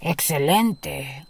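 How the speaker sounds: slow, low greedy giant; low giant growl. A narrator's reading. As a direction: draw it out low and pleased. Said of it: "rugió el gigante de los datos".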